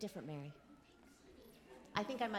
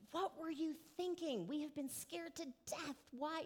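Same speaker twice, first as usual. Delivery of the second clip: imitating a scared, scolding mother, pitched higher; mock worried mom scolding her lost son, higher; frantic mom; 9 semitones higher than usual. Retelling Mary scolding young Jesus, higher mock-frantic voice. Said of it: "his mom's like".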